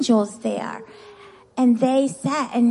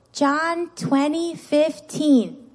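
drawn-out verse reference for group repetition; slow repeat-after-me; much slower than usual. Shown as a demonstration. Teacher leading children in a Bible verse, slow repeat-after-me. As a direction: say it very slowly, word by word. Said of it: "Repeat after me".

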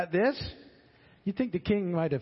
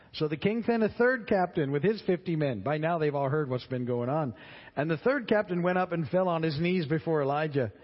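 scripture reading; 4 semitones higher than usual. Acted. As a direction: read the passage out to the class. Reads scripture between comments.

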